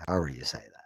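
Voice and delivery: ordinary talk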